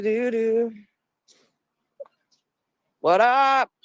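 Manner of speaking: normal talking voice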